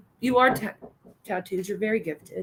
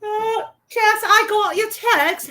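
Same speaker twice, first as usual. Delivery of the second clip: higher, louder mock British accent; teasing a friend in a mock British accent; put-on British accent; 9 semitones higher, 5 dB louder than usual. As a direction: play it up as a silly British accent. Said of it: "hence why I'm talking like this".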